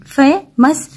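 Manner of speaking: short gap between "fe" and "mas" in famous; split into two syllables. Shown as a demonstration. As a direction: say famous in two separate beats. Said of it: "इनको टू parts में पढ़ते".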